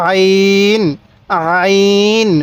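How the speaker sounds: আইন held long with light pressure in the throat; slow model pronunciation of Arabic letter আইন; throat-pressed আইন. Shown as a demonstration. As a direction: lightly squeeze the throat on আইন, sustain it evenly. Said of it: "গলার মধ্যে হালকা চাপ দিলে".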